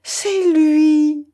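high, loud, excited woman's cry; theatrical high-pitched squeal of recognition; delighted exclamation. A narrator's reading. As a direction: cry out in delight, holding the last word long. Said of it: "s'exclama Alphonsine en battant des mains".

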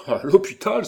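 high, loud exclamation; alarmed cry. A narrator's reading. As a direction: cry it out, appalled. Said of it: "s'écria Jacques Aubry". (cri effaré, aigu et fort)

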